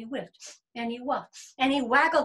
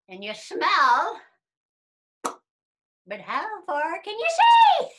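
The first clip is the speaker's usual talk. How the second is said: high worm voice, building louder; cocky old worm; 6 semitones higher, 8 dB louder than usual. Acted. Old worm challenging bear and rabbit, cocky. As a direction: put the big boasters in their place.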